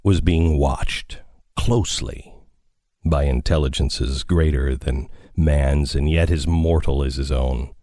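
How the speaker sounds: low, ominous story reading; dramatic narration. Acted. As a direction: read it like an eerie radio drama. Reads War of the Worlds opening.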